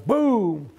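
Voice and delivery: loud, high, drawn-out boom; imitated gunshot. Camper fires another warning shot, loud imitated boom. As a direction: punch out a second rifle blast.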